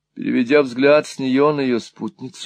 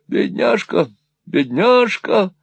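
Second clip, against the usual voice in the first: high cry of pity, drawn out on the repeat; pitying exclamation; 8 semitones higher, slower than usual. A narrator's reading. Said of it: "воскликнул брат Чарльз".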